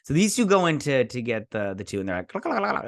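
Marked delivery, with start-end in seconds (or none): 2.3-2.8 high, clipped cluck noises for alien talk; mock alien clucking. Changelings talking in their own language, high mock clucking. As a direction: imitate a strange alien tongue. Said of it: "talking in their weird, you know, uh, founder language".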